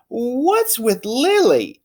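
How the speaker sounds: high, slow, wondering mom; drawn-out worried question; mother puzzling over her daughter, high; puzzled mother. Acted.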